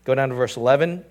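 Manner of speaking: ordinary talk, nothing marked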